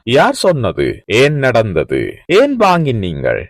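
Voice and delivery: slow, deliberate teacher's questions; slow model questions. Shown as a demonstration. Teacher modelling questions for students, slow and careful. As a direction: lay each question out slowly. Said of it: "எப்படி கேக்குறேன்னு தெரியுமா?".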